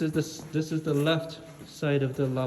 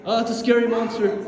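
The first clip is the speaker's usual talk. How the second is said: fake fright; 4 semitones higher, 6 dB louder than usual. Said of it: "Just kidding".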